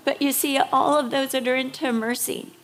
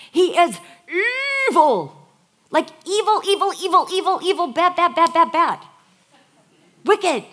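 louder and higher, hammering evil, evil, bad, bad; rising preacher's shout; 6 semitones higher, 7 dB louder than usual. Real, not acted. Preaching to the congregation about wicked Ahab, building shout. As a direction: pile on the words, building to a cry.

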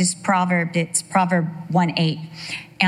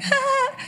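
sudden high yelp of pain. Retold or imitated. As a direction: yelp like you just got burned. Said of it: "And you're like".

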